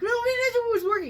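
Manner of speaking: high, loud wail of protest; mock tantrum. Imitating the husband as the lights go off, mock tantrum. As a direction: act out a man starting to lose it. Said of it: "He's starting to throw a tantrum".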